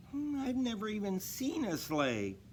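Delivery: put-on high, young reindeer voice; a little doe admitting something; Dasher's voice. A narrator's reading. Dasher telling Santa she never saw a sleigh, high and young. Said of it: "she said".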